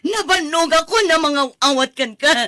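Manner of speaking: 흐느끼며 높고 크게 외치기; 지쳐서 울부짖듯 소리치기; sobbing shout. Acted.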